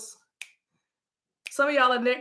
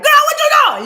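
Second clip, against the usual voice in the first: much higher, very loud yell; loud hollered greeting; 12 dB louder, much faster than usual. Acted. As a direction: holler it in full voice. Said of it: "And when I'm like".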